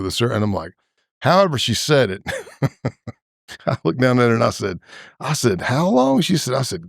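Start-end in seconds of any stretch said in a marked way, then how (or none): 5.6-6.2 sharply higher, stunned ask; retelling his startled reply to the girl; shocked question. Retold or imitated. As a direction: blurt it out in disbelief. Shocked by her story.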